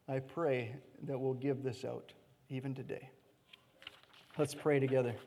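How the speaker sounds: low and quiet; hushed, reverent; soft call to prayer. Real, not acted. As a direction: gently lead into prayer. Calls congregation to prayer.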